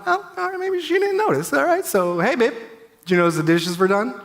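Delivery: husband hinting to wife, high and hopeful; eager fishing for praise. Retold or imitated. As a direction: fish for a compliment like an eager kid. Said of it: "And I thought".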